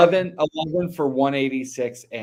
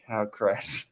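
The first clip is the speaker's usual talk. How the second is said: normal talking voice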